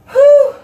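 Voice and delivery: high loud whew of disgust. Real, not acted. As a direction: blow out at the stink. Reacting to stench.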